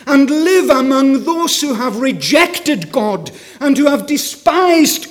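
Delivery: loud, ringing sermon delivery; full-force preaching. Acted. Preacher asks the congregation why Jesus came, preaching at full force. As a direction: preach it out to the whole hall.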